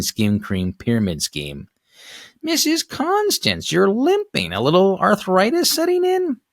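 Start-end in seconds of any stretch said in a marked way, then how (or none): 2.4-6.4 put-on chirpy old neighbor. Neighbor greeting Missus Constance, high old lady voice. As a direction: sound like a nosy elderly saleswoman. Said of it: "Missus Sobalski's voice".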